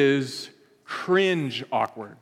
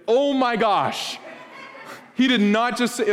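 mock-appalled, high-pitched reaction; mortified disbelief; 6 semitones higher than usual. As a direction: act out secondhand embarrassment, pitched up. Acting mortified reaction to The Office.

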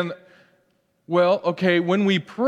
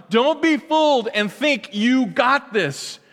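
ordinary talk

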